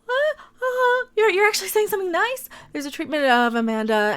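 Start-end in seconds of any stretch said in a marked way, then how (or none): 0.0-2.5 high, fast incredulous squeak; high sarcastic astonishment; mock disbelief. Real, not acted. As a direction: gasp at the unexpected compliment. Shocked at article praise.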